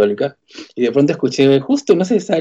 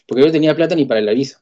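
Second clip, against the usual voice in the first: usual speaking voice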